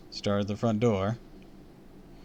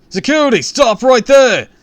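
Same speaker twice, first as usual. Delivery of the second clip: yelling; 18 dB louder than usual. A narrator's reading. Guard orders kids on the stairs, yelling. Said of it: "a man yelled".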